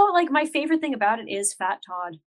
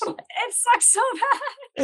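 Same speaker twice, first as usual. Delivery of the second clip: much higher, slow dragged-out scorn; disgusted verdict; 13 semitones higher, much slower than usual. Real, not acted. Co-host groaning about the El Camino movie, slow disgusted whine. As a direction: stretch it out like the movie physically pains you.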